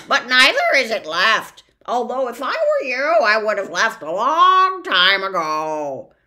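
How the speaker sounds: high, loud know-it-all bird; cheeky bird. Acted. The bird corrects Milo, loud and cheeky. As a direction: lecture Milo like a smug bird.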